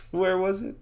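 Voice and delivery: high-pitched, rising self-questioning; straining to recall. Acted. Elmo racks his memory for the door, high and questioning. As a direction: lift it high as you rack your memory.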